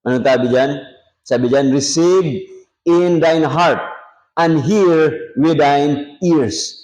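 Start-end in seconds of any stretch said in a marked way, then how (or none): 1.7-6.8 scripture reading. Acted. Preacher reading scripture aloud.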